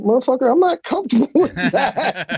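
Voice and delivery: a high, laughing mock protest; amused, high-pitched retold discomfort; laughing retort. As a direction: laugh through the awkward complaint. Retold inner reaction to hug.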